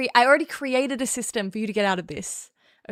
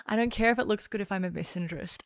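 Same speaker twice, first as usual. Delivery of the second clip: ordinary talk, nothing marked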